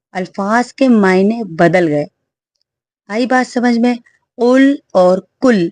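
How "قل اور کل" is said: قل with a heavy throat q, then کل with a light front k; qaf versus kaf. Shown as a demonstration. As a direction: slowly contrast قل and کل. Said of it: "قاف کو موٹا ... باریک".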